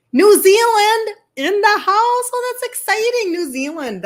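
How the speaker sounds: thrilled to see someone so far away; excited squeal. Real, not acted. Host delighted a New Zealand viewer joined, excited. Reading a faraway viewer's location.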